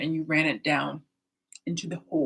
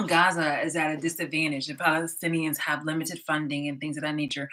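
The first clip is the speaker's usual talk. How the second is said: usual speaking voice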